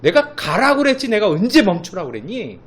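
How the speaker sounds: loud scolding God; God scolding the people, loud; rebuking. Retold or imitated. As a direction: scold them for stopping. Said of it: "이게 질책하시는 거예요".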